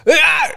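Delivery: loud high startled yell; startled parent cry. Retold or imitated. A parent seeing his kid hit with a golf club, loud startled cry. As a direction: yelp in alarm. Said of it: "I'm like".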